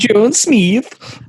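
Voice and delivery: "John Smith" with Swedish vowels, Smith like "Smeeth"; Swedish accent. Shown as a demonstration. Offering a European version of the customer's name, Swedish accent.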